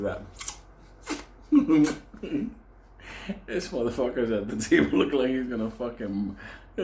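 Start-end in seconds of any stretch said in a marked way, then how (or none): 1.5-2.5 big burst of laughter; cracking up out loud. Real, not acted. Laughs at friend.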